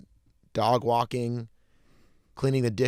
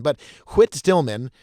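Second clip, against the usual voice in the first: normal talking voice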